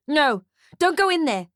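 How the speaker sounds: urgent shout. A narrator's reading. Ferdy stops the mother at the flames, urgent shout. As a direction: stop someone heading into danger.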